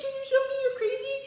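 very high, fast and loud; crazed squeal. Pretend. Asking children to act crazy, high frantic squeal. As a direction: squeal it as if driven mad.

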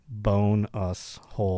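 "bone us hole" in low, separated words; split pronunciation. Acted. Host acting the joker naming it, "bone us hole" in separate words. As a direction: quietly set apart each word of "bone us hole". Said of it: "I'm gonna call it the".

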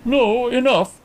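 high, hastily cutting in; hasty concern. A narrator's reading. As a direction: stop him, mildly alarmed. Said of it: "concerned hastily".

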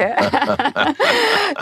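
short warm laugh at herself; fond laugh. Real, not acted. Guest laughing fondly while talking about France. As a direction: laugh fondly at the French grumblers.

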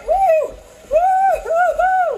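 high loud whooping cheers; repeated whoops. Real, not acted. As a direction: whoop again and again to hype. Cheering class during workout.

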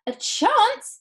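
very high, loud incredulous question; piercing indignant outburst; outraged yell. A narrator's reading. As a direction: yell it back in disbelief. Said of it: "yelled Missus Salt".

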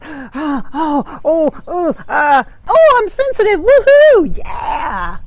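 mock gushing squeal rising to a woohoo; mock sensitive squeal. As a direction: ham up an oversensitive gushing. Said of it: "As I said, there's like this".

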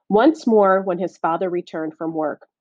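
plain reading voice

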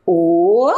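"O" drawn out as a clear open vowel sound; sounding out "O" like a teacher; vowel sound demo. Shown as a demonstration. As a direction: sound out "O" slowly for the class. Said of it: "Olha a pronúncia".

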